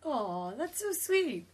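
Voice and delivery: high, drawn-out "aw" of real tenderness; touched cooing. Real, not acted. Co-host reacting to a cute bus story, touched and cooing.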